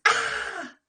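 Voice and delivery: high, loud scream; frightened scream. Acted. Sam screaming at the Jack in the Box. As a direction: shriek at the pop-up.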